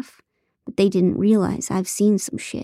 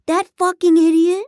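scornful outburst; 11 semitones higher, 6 dB louder than usual. Acted. Ethan scorning Nate.

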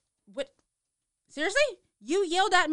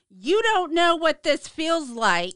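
louder, slow daughter's complaint; whiny complaint; 6 semitones higher, 7 dB louder, slower than usual. Retold or imitated. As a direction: protest it like a hurt daughter.

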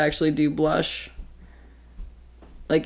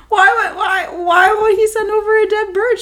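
high, loud, baffled squeal; baffled disbelief; 12 semitones higher, 7 dB louder than usual. Retold or imitated. Retelling the dead bird gift, a high baffled squeal. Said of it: "I was like".

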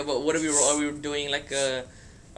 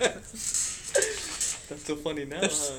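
laughing taunt; 5 dB quieter, much slower than usual. Real, not acted.

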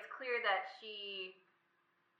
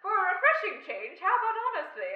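high, loud imperious queen; regal queen; 10 semitones higher, 9 dB louder than usual. Retold or imitated. Queen mocking Charles's dodge, imperious and high. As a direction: deliver it as a cutting royal jab. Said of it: "she says".

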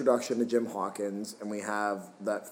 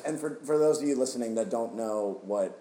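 ordinary talk

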